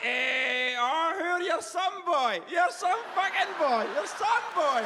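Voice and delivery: hammed-up high-pitched Perthshire holler; strained high mock passion; high shouted reply. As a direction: shout it high, as if carried away. Shouted reply to the eager girl.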